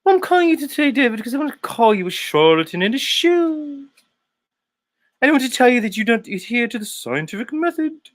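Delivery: very high, squeaky mock caller; Nathan Oakley. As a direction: lodge a complaint in a hammed-up high voice. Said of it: "This is Nathan Oakley".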